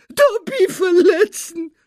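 loud, high squeaky sobs; sobbing. A narrator's reading. Elf answering Harry's apology, sobbing loudly. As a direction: wail it slowly, very high and tearful. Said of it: "schluchzte der Elf".

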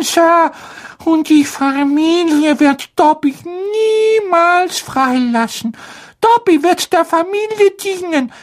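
very high, timid elf voice; a fretful little house-elf; squeaky house-elf voice. Acted. Dobby the house-elf speaks.

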